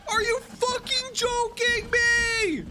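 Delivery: high, slow, stunned squeal; can't believe what just happened; drawn-out incredulous whine; outraged disbelief. Real, not acted.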